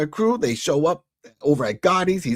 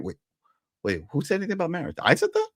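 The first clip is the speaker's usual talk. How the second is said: quick, rising disbelief; mock bewilderment; 4 semitones higher, faster than usual. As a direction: imitate a man baffled by his own words. Acting out Mickey denying the proposal.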